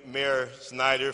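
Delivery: ordinary talk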